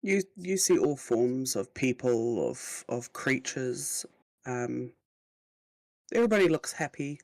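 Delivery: slow storyteller voice; scene narration. Acted. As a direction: set the scene slowly. Game master sets the scene.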